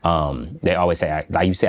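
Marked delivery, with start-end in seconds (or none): none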